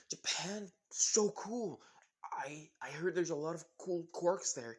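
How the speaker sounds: high-pitched, breathless fangirl awe; starry-eyed excitement. Acted. Jessie gushes to the new boy about quirks, starry-eyed. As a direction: gush with eager wonder. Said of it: "gleam in her eyes about".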